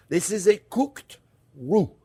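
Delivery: cooked roux, slow and deliberate, every sound clear; careful slow "cooked roux" with a clear final d; said slowly. Shown as a demonstration. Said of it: "I'm saying it slowly".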